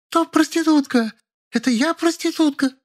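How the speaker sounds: extremely high outraged wail; hysterical scream. A narrator's reading. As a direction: howl it beside herself. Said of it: "завопила не своим голосом". (истошный вопль)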